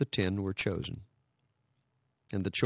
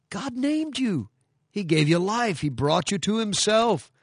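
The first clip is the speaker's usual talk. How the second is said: rising preaching fervor; 11 semitones higher, 4 dB louder than usual. Real, not acted. Preaching climax.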